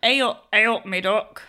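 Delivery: put-on loud Nottingham accent. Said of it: "What's the Nottingham accent?".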